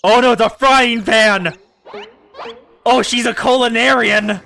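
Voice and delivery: loud delighted outburst; loud streamer reaction; excited alarm. Real, not acted. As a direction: exclaim at the shocking reveal. Excited reaction to game reveal.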